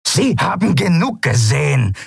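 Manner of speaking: scoffing retort, lightly done; captain snorting back at her officer. A narrator's reading. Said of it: "schnaubte Zaylar".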